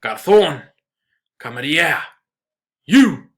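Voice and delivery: loud shouting. Acted. Father hailing the old waiter, boisterous escalating shout. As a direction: bellow across the empty room, each call bigger. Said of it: "hailed the waiter in a loud voice".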